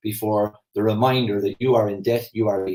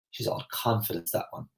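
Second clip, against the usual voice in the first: quiet, begrudging remark, softer than her talk; disapproving gossip; 3 semitones lower, 9 dB quieter than usual. Retold or imitated. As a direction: murmur it like a disapproving neighbour. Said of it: "that's the way they said it".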